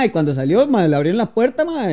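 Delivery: ordinary talk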